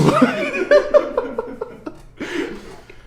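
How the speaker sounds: long, high burst of real laughter; big laugh. Real, not acted. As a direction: laugh hard at your own story. Laughing after the punchline.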